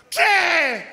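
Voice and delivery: very loud, high furious bellow; yelling like a madman. Acted. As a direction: roar it like a husband gone berserk. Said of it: "gritó como energúmeno".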